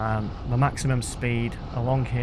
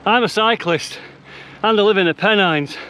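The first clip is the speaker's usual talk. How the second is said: panting, louder and higher pitched; gasping the words out mid-climb; out of breath; 9 semitones higher, 8 dB louder than usual. Real, not acted. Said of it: "if I sound out of breath right now".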